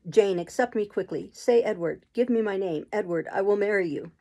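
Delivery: usual speaking voice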